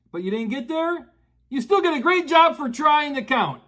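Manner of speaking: usual speaking voice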